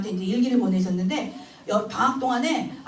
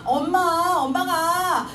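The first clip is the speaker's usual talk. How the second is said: louder eager call; calling mom; 5 semitones higher, 5 dB louder, much slower than usual. Retold or imitated. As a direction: call out to get mom's attention. Son calls his mother. (엄마를 부르는 아들)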